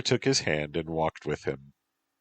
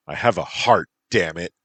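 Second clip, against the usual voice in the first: raised voice, indignant outburst; yelled; 8 dB louder than usual. A narrator's reading. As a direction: burst out louder in mild anger. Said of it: "you yelled at me".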